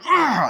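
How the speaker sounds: loud, high imitated roar; attacking yell. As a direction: roar like an attacking killer doll. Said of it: "and then Chucky's like".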